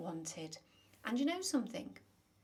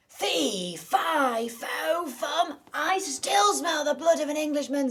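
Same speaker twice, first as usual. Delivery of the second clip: very loud, higher bellowing giant; roaring giant; 7 semitones higher, 15 dB louder, slower than usual. Acted. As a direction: bellow it to shake the castle. Giant wakes and roars.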